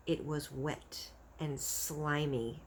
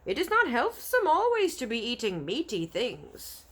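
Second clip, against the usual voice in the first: higher, louder, coaxing giant; eager friendly giant; 6 semitones higher, 5 dB louder than usual. Acted. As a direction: BFG tempting the brute, higher and eager.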